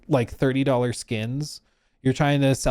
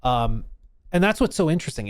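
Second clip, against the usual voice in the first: ordinary delivery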